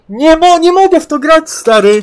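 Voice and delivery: high, loud complaint; exasperated. Real, not acted. Gamer complaining to his friend, exasperated. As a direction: wail that you are fed up. Protesting the game.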